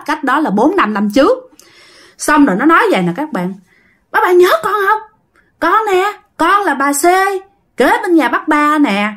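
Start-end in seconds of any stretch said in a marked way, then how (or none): 4.1-9.1 higher-pitched, insistent questioning; ghost girl. Acted. Dead girl reminding her old neighbour who she is, ghost girl voice. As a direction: press the old neighbour to remember you.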